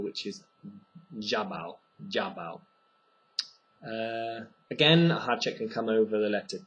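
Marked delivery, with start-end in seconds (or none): 1.2-2.6 ďábel with a soft j start; Czech ďábel. Shown as a demonstration. Teacher modelling the Czech word for devil. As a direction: start djabal with ď like j. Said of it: "the Czech word for devil".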